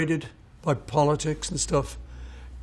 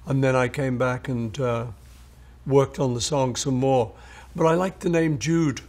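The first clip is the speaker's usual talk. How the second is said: usual speaking voice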